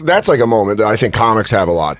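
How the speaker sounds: ordinary delivery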